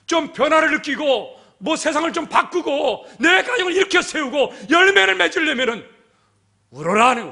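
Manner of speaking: high, loud, driving exhortation; full-force preaching. Real, not acted. Preacher urging the congregation to change and weep, full-force preaching. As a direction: build each phrase forcefully toward the command.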